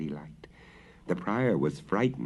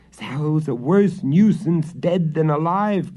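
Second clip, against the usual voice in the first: complaining; 9 semitones higher, 8 dB louder than usual. A narrator's reading. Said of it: "complained the men".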